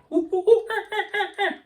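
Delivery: very high hooting monkey laugh; mock monkey shriek. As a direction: hoot like an ape. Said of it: "That's my monkey impersonation".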